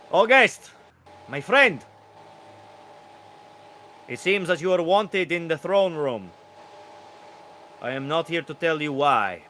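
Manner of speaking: high, loud, crowing courtier voice; royal rooster herald. Acted. Rooster summoning guard to throne room, grand herald voice. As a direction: proclaim it grandly as a rooster messenger. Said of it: "A rooster, wearing beautiful kind of royal garb".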